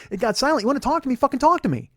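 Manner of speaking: normal talking voice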